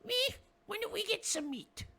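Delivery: very high, squeaky little goblin. Acted. Goblin yelling down the tunnel for meat, high squeak. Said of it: "And he says".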